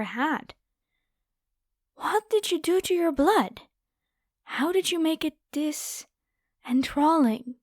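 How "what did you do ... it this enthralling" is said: higher, eager wonder; amazed craving. Acted. Vampire demanding the human's secret, higher and eager. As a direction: marvel hungrily at the taste.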